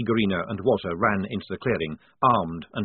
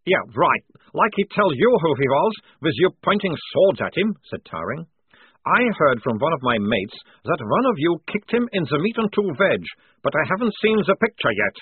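put-on higher, louder accented prisoner; cocky, v-for-w banter; scoffing sergeant; 7 semitones higher, 5 dB louder than usual. A narrator's reading. Prisoner disbelieving the lieutenant's story, scoffing and accented.

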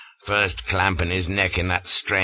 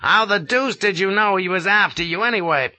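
higher, louder scolding colonel; rasping scold; 12 semitones higher, 4 dB louder, faster than usual. A narrator's reading. Colonel rasping at Flashman for his foolish conduct, scolding. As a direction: rasp out a reprimand. Gardner's voice.

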